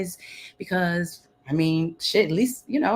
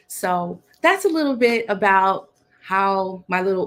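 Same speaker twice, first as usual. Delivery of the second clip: usual speaking voice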